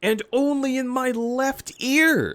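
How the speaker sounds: high, rising incredulous complaint; exasperated disbelief. Real, not acted. Viewer complaining about bad audio, exasperated. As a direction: climb in pitch with indignation. Ranting at a too-quiet audio clip.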